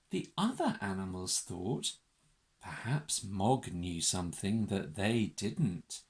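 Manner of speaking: plain reading voice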